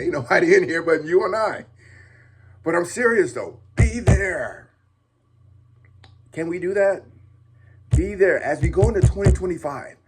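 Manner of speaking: loud, high urgent call; passionate plea. Real, not acted. Dad vlogger urging fathers loudly, passionate. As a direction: drive it home, half laughing at yourself. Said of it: "getting all passionate".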